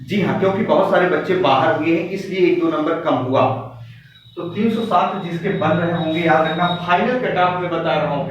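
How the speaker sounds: normal talking voice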